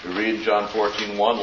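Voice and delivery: ordinary talk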